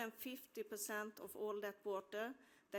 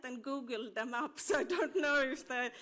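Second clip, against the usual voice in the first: laughing; 4 semitones higher, 9 dB louder than usual. Real, not acted. Farmer laughing about googled words.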